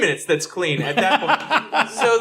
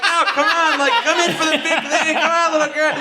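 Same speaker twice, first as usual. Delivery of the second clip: high, eager coaxing; laughing, pushy invitation; cheerful mock coaxing for a hug; 7 semitones higher than usual.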